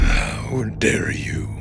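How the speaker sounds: low slow furious whisper; menacing whisper. Acted. Cato furious at Marco's threat, a slow whispered menace. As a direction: hiss it through rage.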